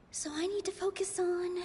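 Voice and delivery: whispered thought to self. Acted. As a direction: murmur it under your breath, unvoiced. Inner thought during the debate.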